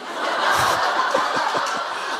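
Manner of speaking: soft airy chuckle; a quiet laugh at his own joke; quiet breathy laugh. Real, not acted.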